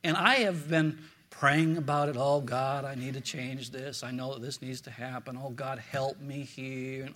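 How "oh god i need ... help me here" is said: a soft, pleading murmur to God; hushed prayer. Retold or imitated. Preacher retelling his prayer, quiet and pleading. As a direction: pray it quietly, almost to yourself.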